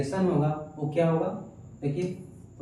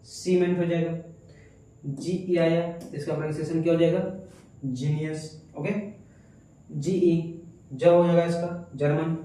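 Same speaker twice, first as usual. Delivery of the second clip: usual speaking voice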